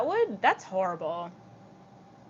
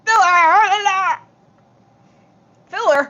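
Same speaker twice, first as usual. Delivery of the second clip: drawn-out goofy voice, pitched up; slow silly voice; 10 semitones higher, 16 dB louder, much slower than usual. Pretend. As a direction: stretch the joke out in a silly voice. Repeats joke in silly voice.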